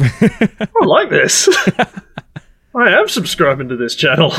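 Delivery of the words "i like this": chuckling through the words; laughing. Real, not acted. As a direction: say it while laughing. Laughing at the drawing.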